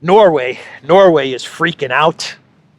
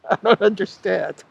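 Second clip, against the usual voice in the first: laughing, higher feigned puzzlement; mock confusion with a laugh; 5 semitones higher than usual. Real, not acted. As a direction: play dumb through a chuckle.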